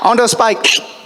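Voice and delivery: high, loud, drawn-out cue; imitated shouted direction; director calling out. Director calling out goblin actions.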